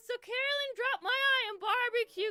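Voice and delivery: high, loud hurried plea; asking the teacher to go to the nurse, giddy; breathless request. Retold or imitated. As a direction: ask the teacher in a giddy rush.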